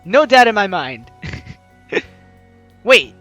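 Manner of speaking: ordinary delivery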